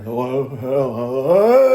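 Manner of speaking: calling out. Real, not acted. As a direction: call out to someone nearing the screen. Calling to someone at screen.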